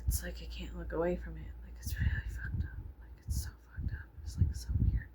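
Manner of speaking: hushed, breathy whisper, fast near the end. Real, not acted. Vlogger whispering how disturbing the show is. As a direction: whisper it like a secret.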